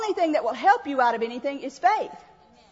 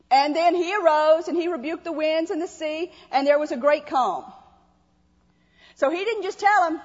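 ordinary talk

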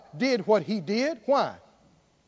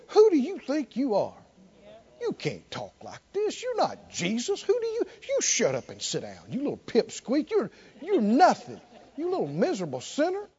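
sneering devil; 2 semitones higher than usual. Retold or imitated. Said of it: "He'll jump on you and go".

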